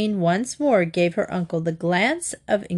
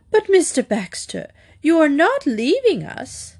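aghast; 8 semitones higher than usual. A narrator's reading. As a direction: gasp it out in disbelief. Lady Constance aghast at news.

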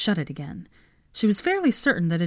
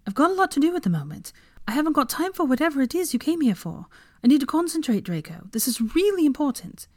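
flustered; 8 semitones higher than usual. A narrator's reading.

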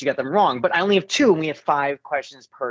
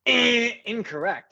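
high, slow wrong-answer buzz; game show buzzer; 6 semitones higher, slower than usual. Acted. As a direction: buzz them out like a host. Host buzzer sound for wrong.

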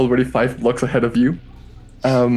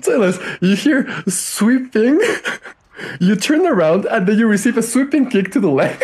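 higher, tickled storytelling; amused narration; 9 semitones higher than usual. Real, not acted. Game master tells Silas of the kick, amused and high. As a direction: barely hold back laughter while narrating.

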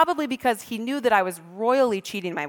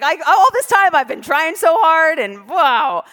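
ordinary talk, nothing marked

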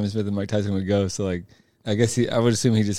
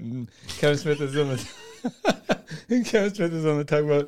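ordinary talk

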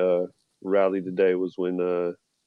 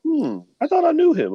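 high, lightly voiced shocked mother; mock shocked mom. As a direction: imitate mom's disapproval. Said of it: "my mom was looking like".

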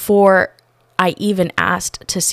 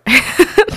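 bright laugh; 12 semitones higher, 6 dB louder than usual. Real, not acted. Laughing at own story.

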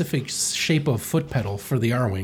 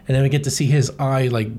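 usual speaking voice

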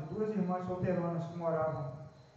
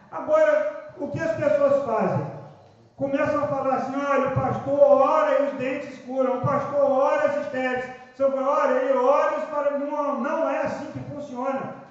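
loud, high sermon climax; full-force preaching; 5 semitones higher, 8 dB louder than usual. Acted. Pastor rebuking how people talk about healing, loud preaching. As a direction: preach it out, rising to the peak.

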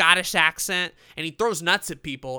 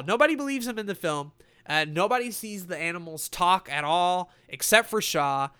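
ordinary delivery

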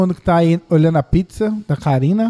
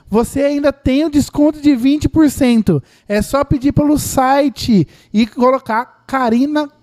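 higher, slow promo announcer read; booming prize announcer; 6 semitones higher than usual. Acted. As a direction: sell the discount like a prize. Plugs the discount code live.

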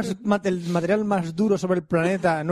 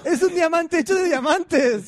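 mock announcer; 10 semitones higher, slower than usual. Dramatic superhero announcement.

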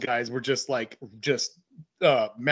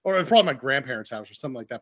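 ordinary talk

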